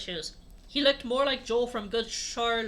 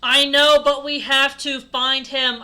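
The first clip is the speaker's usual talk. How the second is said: loud, slow, higher declaiming; smarty; 4 semitones higher, 12 dB louder, slower than usual. Acted. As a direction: belt it out with deliberate emphasis. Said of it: "I said, smarty".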